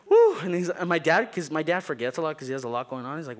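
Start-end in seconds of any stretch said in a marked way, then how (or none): 0.1-0.4 high, loud whoop; cheer. Retold or imitated. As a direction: celebrate the finish. Cheers finishing homework.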